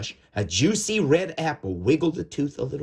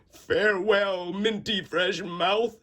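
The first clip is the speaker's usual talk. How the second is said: higher, slowed little tooth voice; fond farewell; 7 semitones higher than usual. Acted. Tooth bidding farewell to his old mouth, wistful. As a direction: say goodbye wistfully. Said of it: "he said".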